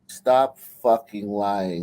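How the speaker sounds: low, slow, drawn-out scolding; exasperated rebuke. Real, not acted. Host scolds the woman in the clip for lying, slow exasperated rebuke. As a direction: stretch each word in disgusted disbelief.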